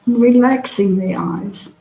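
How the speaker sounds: calm, unhurried meditation guide; guided meditation. Real, not acted. Teacher settling an online class into body scan, guided meditation. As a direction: talk them gently through relaxing, with long pauses.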